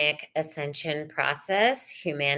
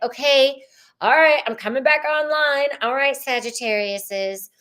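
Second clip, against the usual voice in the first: higher, louder coming-back-online voice; waking up; 5 semitones higher, 4 dB louder than usual. Retold or imitated. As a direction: snap back awake, playfully.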